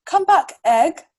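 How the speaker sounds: mother chicken calling her egg. Acted. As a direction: call out to the runaway egg. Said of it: "chicken calls".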